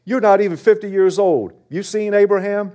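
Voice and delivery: high-pitched, disbelieving retort; incredulous challengers. Acted.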